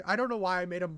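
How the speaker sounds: ordinary talk, nothing marked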